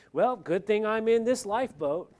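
loud, high, smug and dismissive; smug pastors. Retold or imitated. Pastor mocking smug preachers on the Titanic, loud smug gloating. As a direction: gloat from the lifeboat. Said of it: "they're saying".

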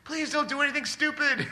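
high, loud exasperated plea; parent pleading. Retold or imitated. Parent of a grown child, pleading loudly not to act foolishly. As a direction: beg them not to mess up, half laughing.

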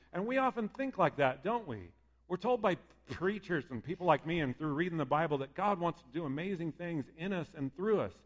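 ordinary talk, nothing marked

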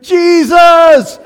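loud, high shout of alarm; acted-out cry from a dropping plane; imitated crying out. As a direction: call out for help at full volume. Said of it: "She called out to him".

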